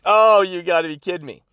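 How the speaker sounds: mock-indignant outburst over the blocked kick; outraged disbelief. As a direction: let it burst out at the selfish crowd. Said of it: "So I was like".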